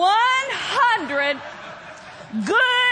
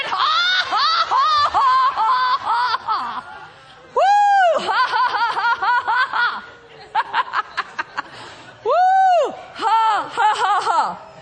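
demonstrated laughter workout; 7 semitones higher than usual. Shown as a demonstration. Preacher working the midsection, demonstrated laughter workout. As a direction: force out bursts of laughter and whooping. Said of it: "Faking a laugh like I just did".

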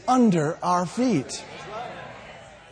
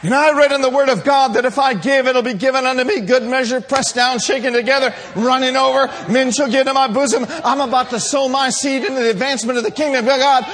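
fast, loud, rising sermon run; full-force preaching; 4 semitones higher, 5 dB louder, faster than usual. Acted. Believer declaring scripture to the devil, full-force preaching. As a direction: rattle the promises off loudly without letting up.

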